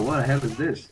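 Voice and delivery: usual speaking voice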